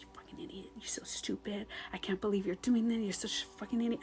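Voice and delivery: whispered self-insults, very soft; verbally aggressive self-talk. Retold or imitated. Speaker shaming themselves when angry, whispered verbal aggression. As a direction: berate yourself under your breath in a retold whisper. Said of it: "I was verbally aggressive to myself".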